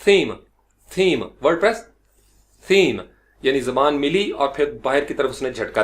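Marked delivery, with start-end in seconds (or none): 0.1-3.0 "theme" with the tongue flicked outward; exact English th. Shown as a demonstration. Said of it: "अगर आप exact सीखना चाहते हैं".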